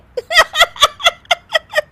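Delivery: very high helpless giggle; high giggling fit. Acted. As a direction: burst into a giggle you can't hold in. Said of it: "Anshu फिर से हंसने लगती है". (ऊँची बेकाबू हंसी)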